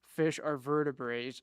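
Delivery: normal talking voice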